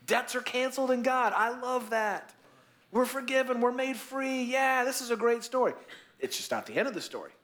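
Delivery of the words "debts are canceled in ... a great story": Peter's eager delight, pitched higher. Retold or imitated. Peter tells Jesus he loves the story, eager. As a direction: beam at the story like a pleased disciple. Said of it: "He's probably liking this story".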